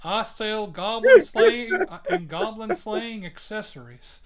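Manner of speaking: a hint of a gruff Texan propane salesman; Hank Hill. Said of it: "Just make him sound like Hank Hill".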